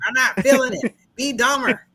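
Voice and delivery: loud, high mock directing; demanding director. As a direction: push the actor harder, laughter creeping in. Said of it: "Could you imagine the directors doing this".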